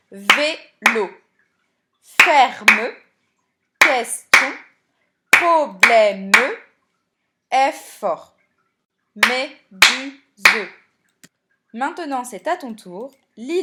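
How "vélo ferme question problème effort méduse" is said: "Vélo, ferme, question, problème, effort, méduse" loudly broken into separate syllables. Shown as a demonstration. Teacher showing pupils how to tap syllables, "Vélo, ferme, question, problème, effort, méduse" loud and chopped by syllable. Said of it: "les mots ont été coupés en syllabes".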